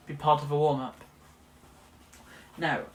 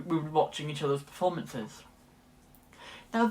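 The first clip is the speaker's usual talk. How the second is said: ordinary talk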